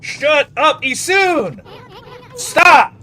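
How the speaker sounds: high, very loud angry shouting; furious yell building to a blast; pissed off. Real, not acted. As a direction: scream at it to stop, real rage. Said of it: "it's pissing me off".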